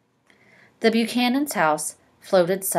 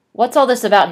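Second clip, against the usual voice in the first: demanding; 5 semitones higher, 9 dB louder than usual. A narrator's reading. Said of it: "he demanded".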